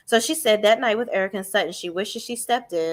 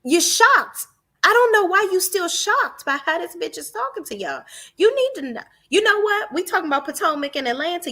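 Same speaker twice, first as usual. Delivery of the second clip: high-pitched, incredulous telling-off; exasperated scolding; 10 semitones higher, 3 dB louder than usual. Real, not acted. Vlogger to the silent castmates, high exasperated rant. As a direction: scold them with the voice pitched right up.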